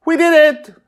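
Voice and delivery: high, loud shout of victory; triumphant cheer. Acted. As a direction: yell it in pure triumph. Children cheer the spell.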